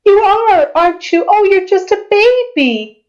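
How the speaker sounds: high, slow doting voice; cooing ancient being. Acted. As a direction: coo over a newcomer as over a baby. Ancient being coos over newcomer.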